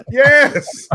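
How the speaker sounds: high bright retort; answering the insult with fake cheer; cheery mock reply. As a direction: toss it back cheerfully.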